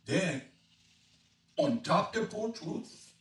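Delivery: rollicking Cajun rhyme, rising in pitch; Cajun dialect reading. Acted. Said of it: "an expert on Cajun dialect".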